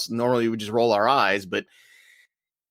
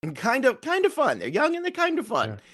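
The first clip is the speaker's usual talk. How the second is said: very high, teasing falsetto; playful echo; 11 semitones higher than usual. Retold or imitated. Echoing co-host's praise of the young team, playful high-pitched voice. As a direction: pitch it way up, lightly mocking.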